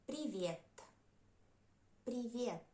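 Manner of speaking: привет said "pri-VYET" for learners; unstressed Е as И. Shown as a demonstration. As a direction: model привет with stress on the last syllable. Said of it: "we pronounce it like И".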